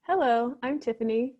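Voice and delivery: ordinary talk, nothing marked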